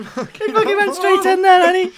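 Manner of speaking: laughing. Real, not acted. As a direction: squeal it through laughter. Laughing in disbelief.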